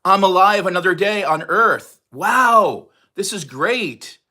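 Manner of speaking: loud, high, gushing delight at being alive; mock cheerful wake-up. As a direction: burst out with joy at a new day. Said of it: "you're like".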